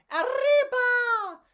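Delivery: high, loud, slow whoop. Acted. Pedro whooping as the adventure begins. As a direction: whoop it out with joy. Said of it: "Pedro whooped".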